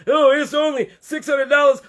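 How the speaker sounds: a hint of a whiny complainer; mocking whiny complainer. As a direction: mimic the Facebook complainers, lightly hammed-up. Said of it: "y'all start complaining on Facebook about".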